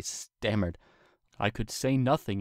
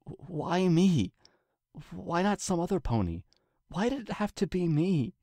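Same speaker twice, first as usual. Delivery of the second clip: much higher, pleading; Twilight's anguished plea; 10 semitones higher than usual. A narrator's reading. Twilight begs the king for answers, higher pitched. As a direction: beg to know why it's her.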